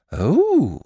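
high, happily dawning "oh"; light up happily in a high bear voice; pleased little high-pitched exclamation; happy bear. Acted. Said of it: "said Pooh happily".

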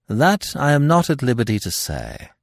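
plain reading voice

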